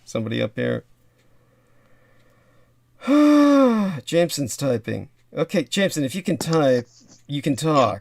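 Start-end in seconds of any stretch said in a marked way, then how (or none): 3.0-4.0 big, loud, high sigh. Real, not acted. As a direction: heave a loud sigh of impatience. Waiting, nobody on the line.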